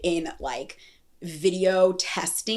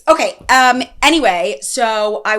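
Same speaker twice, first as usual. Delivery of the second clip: loud; 4 semitones higher, 9 dB louder than usual. Real, not acted. Snapping back to the topic.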